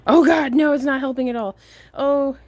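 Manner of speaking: sudden alarmed outburst; startled. Real, not acted. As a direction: jolt up in alarm. Bug lands, sudden alarm.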